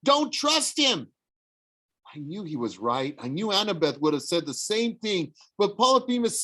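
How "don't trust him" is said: warning. A narrator's reading. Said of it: "Grover warned".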